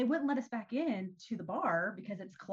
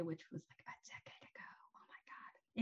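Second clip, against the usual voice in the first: quiet embarrassed whisper; whispered aside; 5 semitones lower, 15 dB quieter than usual. Real, not acted. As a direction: drop to a whisper. Aside about her age.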